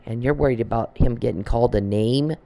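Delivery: usual speaking voice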